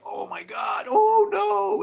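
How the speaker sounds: high wailing cry of dismay; mock horror. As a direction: cry out in rising, hammed-up horror.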